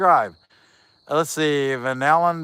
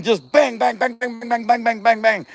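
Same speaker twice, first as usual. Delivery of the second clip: rapid higher bang bang, knocking on wood; mock knocking sounds, pitched up; imitated pecking bangs; 7 semitones higher, 3 dB louder than usual. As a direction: rattle off the bangs like a woodpecker hammering.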